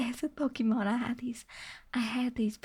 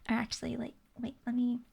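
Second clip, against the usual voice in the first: normal talking voice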